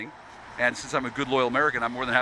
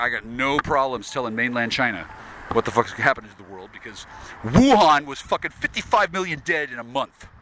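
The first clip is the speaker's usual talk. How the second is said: ordinary talk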